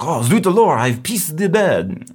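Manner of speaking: high, loud mock French cry of alarm; hammed-up French dismay. Joking about a Frenchman who wet the bed, in a high, loud hammed-up French accent. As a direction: ham up a French guy waking to a wet bed. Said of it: "and it's like".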